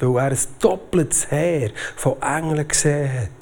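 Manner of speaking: ordinary delivery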